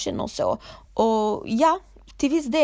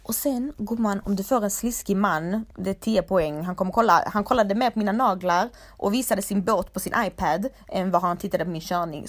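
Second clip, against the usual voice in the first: ordinary delivery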